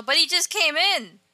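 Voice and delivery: mock indignant exclamation; protesting. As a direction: insist he just came in, voice up. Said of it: "And Jimmy's all".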